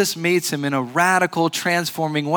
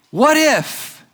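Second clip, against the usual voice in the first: "What if?" lifted very high and louder; emphatic high question; 9 semitones higher, 3 dB louder than usual. Acted. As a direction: cry out "What if?" in rising anguish. Repeats again, even higher.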